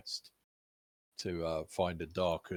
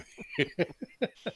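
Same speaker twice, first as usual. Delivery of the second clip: high laugh; 13 semitones higher than usual. Real, not acted. Laughing at the joke.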